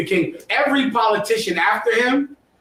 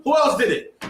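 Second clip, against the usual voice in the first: ordinary talk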